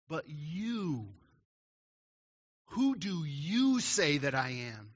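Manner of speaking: slow, pointed question leaning hard on YOU; emphatic. Retold or imitated. Jesus turns the question on his disciples, very emphatic and direct. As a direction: press the question right into their face, stressing YOU. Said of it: "It's a very emphatic, almost pointing in your face".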